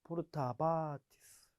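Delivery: 포르타 바티스 said slowly; slow drill. Shown as a demonstration. Teacher drills 포르타 바티스 slowly for the class. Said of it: "포르타 바티스도 마찬가지죠".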